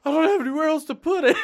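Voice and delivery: high, loud, fast laughing protest; overwhelmed, laughing. Retold or imitated. Overflowing money, acted laughing quote.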